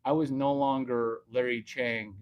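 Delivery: ordinary delivery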